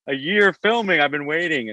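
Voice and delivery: high, keyed-up inner voice; eager anticipation. Retold or imitated. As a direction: sound like the big moment has finally come. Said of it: "I was like".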